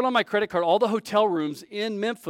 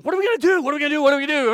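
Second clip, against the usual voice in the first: high, loud, anxious repeating; worried voices spiralling; panicked asking; 10 semitones higher, 6 dB louder, much faster than usual. Retold or imitated. Stranded travellers panic at night by the road, high and loud.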